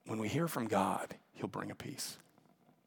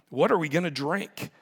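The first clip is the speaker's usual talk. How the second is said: higher-pitched complaint, read aloud; lightly acted demand, pitched up; 5 semitones higher, 4 dB louder than usual. A narrator's reading. Thirsty people turning on Moses, demanding. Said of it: "they demanded".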